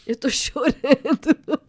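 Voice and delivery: slow, loud, half laughing, half crying; laughing through tears. Real, not acted. To the pastor about crying live, teary laugh. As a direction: laugh and cry on the word.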